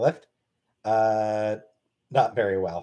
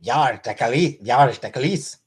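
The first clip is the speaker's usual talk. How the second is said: a hint of Québécois swearing; mock French; slower than usual. Shown as a demonstration. Speaking French for chat, mock Québécois. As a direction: toss out some fake French. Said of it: "Speak French".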